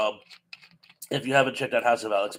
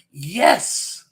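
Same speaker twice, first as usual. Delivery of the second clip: excited; 5 semitones higher, 4 dB louder than usual. Real, not acted. Guessed trivia answer correct.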